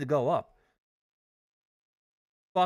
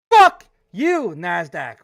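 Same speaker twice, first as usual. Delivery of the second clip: a real, high, loud furious yell; furious shout; 4 semitones higher, 13 dB louder, slower than usual. Real, not acted. Trader screaming at the market on his stream, furious shout. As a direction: blast it out at the screen in real rage.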